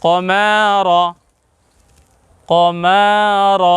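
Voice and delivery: "কমার" slowly joined as ko-maa-ro; joined model pronunciation. Shown as a demonstration. Teacher modelling the whole word, slow and joined. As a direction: draw out "কমার" evenly, ending on rounded ro. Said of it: "তাহলে উচ্চারণ কেমন হলো?".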